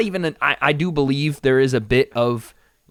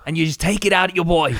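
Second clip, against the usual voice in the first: higher-pitched, muddled Irish-British impression; bad Connor accent; 5 semitones higher than usual. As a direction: coach someone in a wobbly mock Irish accent. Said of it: "I don't know what accent I'm doing".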